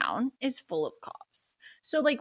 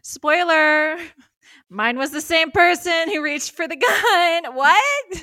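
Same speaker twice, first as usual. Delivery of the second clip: higher, louder giddy reveal; excited; 7 semitones higher, 6 dB louder, slower than usual. Real, not acted. Teasing her friend about the same gunman, giddy and loud. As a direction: burst out with the spoiler, giddy.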